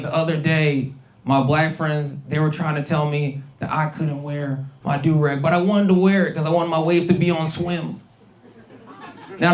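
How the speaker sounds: normal talking voice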